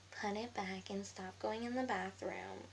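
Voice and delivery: slow, drawn-out copycat voice; mocking mimicry. Girl mimicking her classmate's order, slow mocking singsong. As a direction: parrot the order back in a put-on voice. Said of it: "So I mimicked her".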